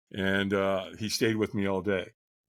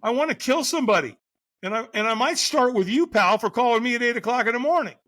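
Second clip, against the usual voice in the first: much higher, louder hammed-up anger; mock furious rant; 12 semitones higher, 5 dB louder than usual. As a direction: snap back at the early caller in mock fury. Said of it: "And I'm going".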